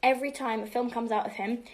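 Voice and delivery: ordinary delivery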